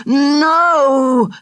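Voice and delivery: high anguished cry of refusal; desperate no. Acted. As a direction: cry out against waking. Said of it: "said his mind".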